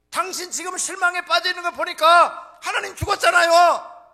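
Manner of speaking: high, very loud yelling back; acted shouted retort. Wife rebuking despairing Luther, acted shouted retort. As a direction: hurl the accusation back at full volume.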